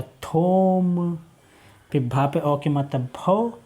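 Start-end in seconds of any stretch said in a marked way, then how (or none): 0.0-1.2 झील stretched very slowly; drawn-out blending. Shown as a demonstration. Teacher slowly sounding out the blended word. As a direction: draw झील out for the children. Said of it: "तो क्या हो गया?".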